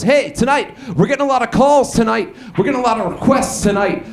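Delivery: high, hyped-up radio DJ patter; imitating a pumped-up FM host. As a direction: put on an excited DJ voice. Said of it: "the D J goes".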